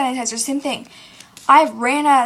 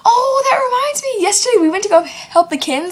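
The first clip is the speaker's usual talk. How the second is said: mock interrupting friend; 9 semitones higher, 6 dB louder than usual. Friend Brianne interrupting her story, loud mock excitement. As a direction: burst in over someone, mocking their excitement.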